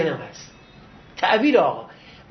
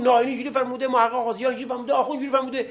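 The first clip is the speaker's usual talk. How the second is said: higher-pitched, quick run of citations; rapid-fire citing; 4 semitones higher, faster than usual. Retold or imitated. Teacher citing scholar after scholar, rapid higher-pitched listing. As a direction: reel off the authorities like the master did.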